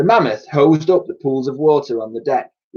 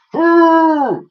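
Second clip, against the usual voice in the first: much higher, louder hurrah; called out cheer; 6 dB louder than usual. A narrator's reading. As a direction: cheer it like a happy mammoth. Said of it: "cried Woolley".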